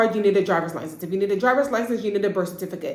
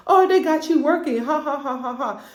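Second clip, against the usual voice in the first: mocking laugh; 6 semitones higher, 3 dB louder than usual. Dad mocking self-checkout.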